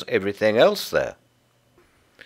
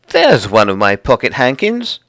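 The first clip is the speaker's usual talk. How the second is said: put-on loud, higher child's exclamation; excited cry; 5 semitones higher, 10 dB louder than usual. Lucy spots her lost hankins, crying out excitedly. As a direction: cry out at the find. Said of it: "cried Lucy".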